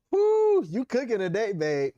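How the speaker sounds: high, loud whoop of praise; excited woo. Real, not acted. Wife hyping her husband after a sharp point, high excited woo. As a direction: hype him up.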